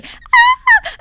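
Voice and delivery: high, loud imitated shriek; screaming aunts. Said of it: "my tias are just screaming".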